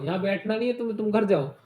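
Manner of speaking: usual speaking voice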